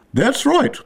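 high, mildly shouted approval; high-pitched royal cry. A narrator's reading. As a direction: call it out shrilly as the queen. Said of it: "shouted the Queen".

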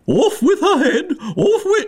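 very high, shrill furious queen scream; screeching tyrant queen. A narrator's reading. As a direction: shriek the order in a royal rage.

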